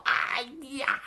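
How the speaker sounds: high, soft drawn-out wail; mock death cry, held back in volume; acted scream of agony. A narrator's reading. Storyteller voices Wu's last cry, high muted wail. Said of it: "一声惨叫".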